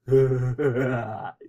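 acted scoffing laugh; amused, menacing laugh; thug's mocking chuckle. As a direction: chuckle derisively at a polite victim. Thug amused by polite detective.

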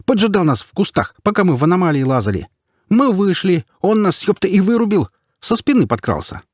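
high, agitated accuser; worked-up, high-pitched tattling; insistent marauder. A narrator's reading. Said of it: "не унимался мародёр".

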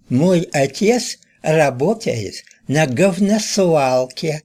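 high, slow sing-song through a held tongue. A narrator's reading. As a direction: chant it with the tongue pinched. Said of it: "нараспев произнёс".

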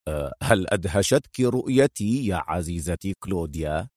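low voice, amused sarcasm; sarcastic. Acted. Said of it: "في سخرية".

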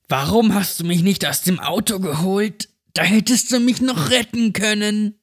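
put-on high, eerie accusing child's voice; possessed girl. A narrator's reading. The possessed girl reproaches Mark for leaving her, eerie and high. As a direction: accuse him like a haunted child.

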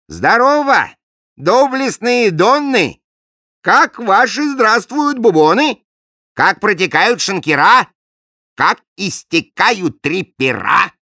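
high, loud, slow royal hail; booming king greeting. Acted. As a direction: hail the guests grandly.

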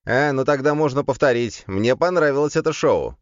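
high-pitched, gleeful demon voice; amused demon. A narrator's reading. Demon asking to repeat the show, amused. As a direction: lift the pitch high, relishing the spectacle. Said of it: "рассмеялся демон".

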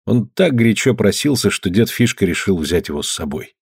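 ordinary delivery, nothing marked

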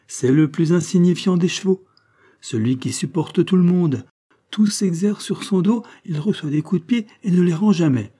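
high, lightly voiced Rosette; insistent young circus rider. Rosette talking up the meekest horse, insistent.

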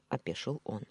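usual speaking voice

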